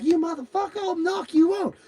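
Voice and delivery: very high-pitched, loud mock threat; lipping off. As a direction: imitate her squawking a threat. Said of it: "he's lipping her off".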